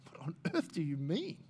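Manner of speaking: hushed, disbelieving question; baffled and under the breath; incredulous. Acted. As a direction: ask it softly, as if stunned by the claim. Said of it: "would be incredulous about that".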